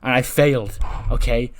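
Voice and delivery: loud, frustrated outburst; snapped. Acted. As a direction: snap back, admitting failure loudly. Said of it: "Sami sort of snapped and goes".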